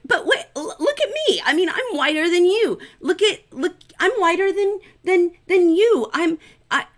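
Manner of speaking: high, frantic, stammering plea; panicked wife protesting her whiteness; high desperate babble. Retold or imitated. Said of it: "And she's like".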